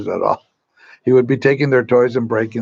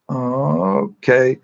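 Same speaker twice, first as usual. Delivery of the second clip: reluctant agreement; 3 semitones higher than usual. Acted. Mother gives in reluctantly.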